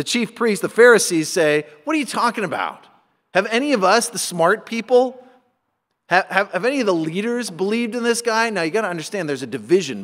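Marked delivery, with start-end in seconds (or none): 1.8-8.5 higher-pitched, condescending questions; scornful disbelief. Acted. Pharisees to the guards who came back empty-handed, scornful. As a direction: talk down to them as the smart people.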